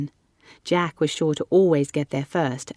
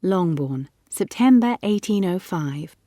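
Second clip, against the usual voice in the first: usual speaking voice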